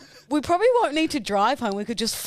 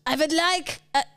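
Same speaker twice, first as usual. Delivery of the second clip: put-on higher-pitched French accent, left hanging; 4 semitones higher than usual. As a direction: start an order in mock French. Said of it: "what else do the French do?".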